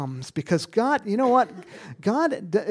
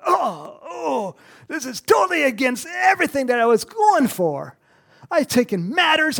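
higher, louder panicked cry as Saul; pretend Saul panicking; 6 semitones higher, 7 dB louder than usual. Preacher imagining Saul's reaction, panicked shouting. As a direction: let Saul's dismay shout out. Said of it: "I'd be going".

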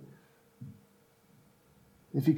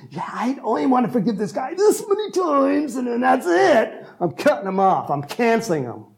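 higher, put-on voice of a grudging man; mocking unforgiving believer; 7 semitones higher than usual. Pastor mimics a grudging believer, mock higher voice. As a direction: play the petty grudge-holder lightly.

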